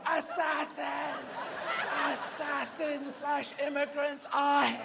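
high, long-drawn howled alarm; completely sure dog. Acted. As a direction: howl each word with total conviction. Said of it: "they're completely sure".

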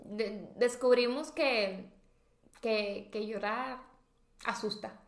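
slow, low, soft delivery; deadpan punchline. Real, not acted. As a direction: drop it flat and slow for the joke. Remate cómico lento y bajo. (remate seco, lento y bajo)

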